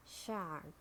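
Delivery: "shark" with a sounded American R; American "shark", curl the R before k; American accent. Shown as a demonstration. Teacher models shark for learners, American accent. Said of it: "R in American English".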